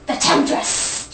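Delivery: slow, unvoiced stage whisper; hushed, hammed-up drama; theatrical whisper. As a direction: hiss out the mock-scandalous reveal. Repeated gag word, hammed up.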